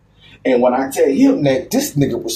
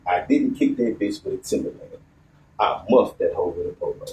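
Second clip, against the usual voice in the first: softer, near-whispered repeat of the line; quiet retelling; 5 semitones lower, 5 dB quieter than usual. Retold or imitated. Storyteller repeating friend's excuse to audience, hushed. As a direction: say it low and hushed.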